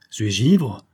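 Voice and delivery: mildly acted disbelieving question; stunned exclamation. A narrator's reading. Count stupefied that the lady greets a brother, exclaiming. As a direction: cry out in bewilderment. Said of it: "s'écria le comte".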